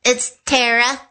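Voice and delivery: "Tara" corrected to TAIR-uh; TAIR-uh pronunciation. Real, not acted. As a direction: say "Tara" with an "air" vowel in the first syllable. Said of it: "I don't know if it's Tara".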